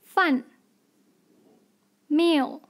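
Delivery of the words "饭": 饭 in a falling fourth tone. Shown as a demonstration. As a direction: drop the pitch sharply through 饭. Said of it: "fourth tone".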